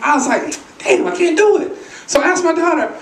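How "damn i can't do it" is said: high-pitched retold exasperation; mock frustration. Dad failing to roll his eyes, mock frustration. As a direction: give up in comic frustration.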